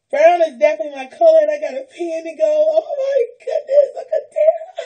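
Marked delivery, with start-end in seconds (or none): 2.7-4.8 high, tearful, laughing exclamation; crying with joy. Real, not acted. Thanking a friend for gifts, tearful joy. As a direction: let the happy tears break through.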